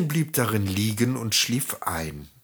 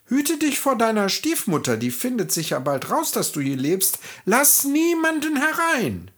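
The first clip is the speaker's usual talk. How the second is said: much higher, earnest little warning voice; dwarfs' warning; 11 semitones higher than usual. A narrator's reading. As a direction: caution her urgently. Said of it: "die Zwergen warnten".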